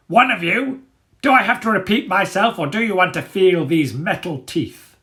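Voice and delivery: high, loud menacing dog voice; threatening Dagger. A narrator's reading. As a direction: threaten them impatiently.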